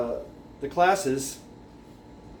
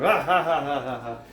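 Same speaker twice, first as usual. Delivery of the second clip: mocking laughter. Students laughing at him.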